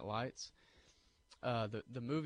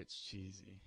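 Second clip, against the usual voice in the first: "cheesy" dropped much softer, almost under the breath; soft, half-apologetic "cheesy"; muttered repeat; 3 semitones lower, 10 dB quieter, slower than usual. Real, not acted. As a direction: mumble "it's cheesy" the second time. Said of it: "I'm sorry".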